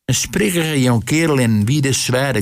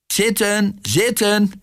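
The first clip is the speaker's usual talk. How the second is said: high-pitched heckle; high call from the row behind; 9 semitones higher, slower than usual. A narrator's reading. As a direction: order them down in a high voice. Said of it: "reed een poorlu achter haar".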